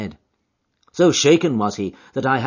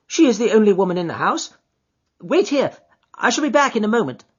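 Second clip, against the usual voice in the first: high, quick, fussy gentleman; a high, hurried little man giving orders; fussy little Sholto; 12 semitones higher, 7 dB louder, faster than usual. Acted. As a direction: voice the nervous host, fast and high. Sholto voiced by the reader.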